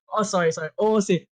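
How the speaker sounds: usual speaking voice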